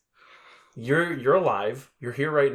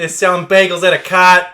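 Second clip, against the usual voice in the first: loud, higher fake Boston accent; 5 semitones higher, 10 dB louder than usual. Clowning for a friend in bad Boston accent. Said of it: "not a proper Boston accent".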